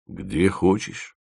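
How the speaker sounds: slow, soft, sullen reply; gloomy. A narrator's reading. Dima answers his friend's question, gloomy. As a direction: drag it out glumly. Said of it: "мрачно сказал Дима".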